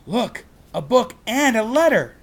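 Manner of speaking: put-on high, loud girl voice; a girl pointing out her find; excited Annie. Annie finds things.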